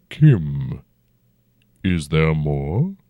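slow, rumbling ancient dragon. A narrator's reading. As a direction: speak as a huge old dragon, unhurried. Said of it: "the dragon said".

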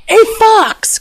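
high, drawn-out cry of alarm. A narrator's reading. King spots the fox in his palace, high alarmed cry. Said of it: "King Haite cried".

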